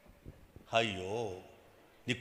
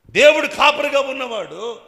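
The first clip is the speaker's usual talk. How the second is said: preacher's cry; 13 semitones higher, 18 dB louder than usual. Acted.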